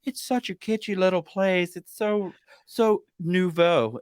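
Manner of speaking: mock the pretentious reviewer, stretch out nouveau; snooty reviewer. Mocking a Yelp reviewer who calls the place kitschy, high and snooty. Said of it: "Or they'd be like".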